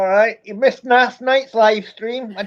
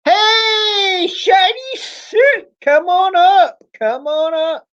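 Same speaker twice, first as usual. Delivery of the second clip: loud host call-out, higher; 10 semitones higher, 3 dB louder, slower than usual. Acted. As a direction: call them up on stage. Calling viewer onto stream.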